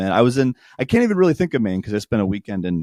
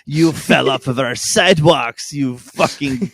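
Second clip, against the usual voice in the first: a hint of jeering Quebec locals; lightly voiced taunting crowd; mocking locals; 3 semitones higher, slower than usual. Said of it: "pointed and laughed at us and said".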